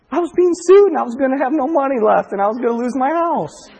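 a hint of joyful irony; gleeful irony. Mocking joy over disaster.

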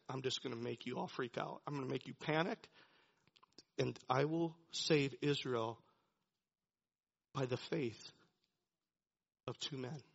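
low, soft resolve; hushed, certain voice of God; quiet determination. Retold or imitated. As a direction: promise it softly and firmly. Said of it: "in a moment of determination".

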